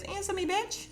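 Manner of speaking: high-pitched mock demand; imitated impatient demand. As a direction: press for an answer, pitch jumping right up. Retold impatient demand for an answer.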